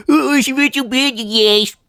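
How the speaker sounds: high, whining mock grief. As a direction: parody a grown man mourning a game pet. Mocking Phil's grief.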